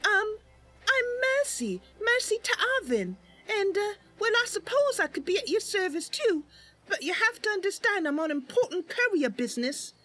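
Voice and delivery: put-on high, polite young woman voice; Mercy's voice. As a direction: introduce yourself a bit nervously. Mercy introduces herself.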